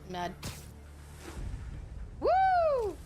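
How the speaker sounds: normal talking voice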